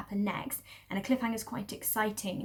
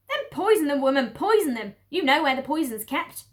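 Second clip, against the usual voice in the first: loud, higher curt order; bossy mistress; 4 semitones higher, 8 dB louder than usual. Acted. Lady upstairs orders rats poisoned, loud and curt. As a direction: snap the order. Mrs Bean voice.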